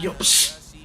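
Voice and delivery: hushed psst to a classmate; sneaky whisper. Retold or imitated. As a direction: whisper so the teacher won't hear. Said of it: "trying to be inconspicuous".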